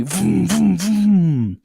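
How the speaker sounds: high, loud revving engine noise; engine vroom. Retold or imitated. Narrator mimicking Damien's wild driving, high loud vrooms. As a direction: rev it like a hammed-up race car. Imitating car engine in the boot.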